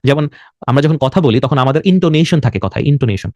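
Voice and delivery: usual speaking voice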